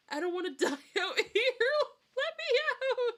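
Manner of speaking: very high, loud mock panic breaking into laughter; laughing desperate yell. Trapped player screaming at the game not to die, laughing mock panic. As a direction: scream to be let out while cracking up.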